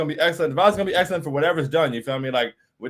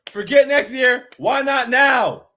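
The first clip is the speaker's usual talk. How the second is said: hyped up; 5 semitones higher, 6 dB louder, slower than usual. Real, not acted. Hyped streamer rallying the fans.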